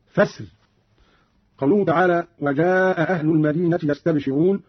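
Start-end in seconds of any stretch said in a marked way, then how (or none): 0.1-0.4 فاسر stopped as "fasr" with a thin r; higher-pitched فاسر, cut off on a light r; light final ra. Shown as a demonstration. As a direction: pause on فاسر, softening the ra without a vowel. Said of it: "يقف بترقيق الراء وهو المقدم هكذا".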